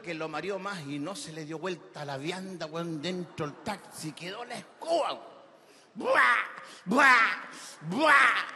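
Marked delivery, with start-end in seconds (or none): 5.9-8.4 loud, high retching noises; hammed-up puking sound; mock vomiting. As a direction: imitate heaving three times, loudly. Drunk vomits in the taxi.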